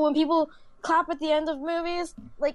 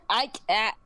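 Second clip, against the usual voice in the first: talking through coughs; coughing between words; coughing fit; 3 semitones lower than usual. Real, not acted. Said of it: "Sorry, I'm coughing right now".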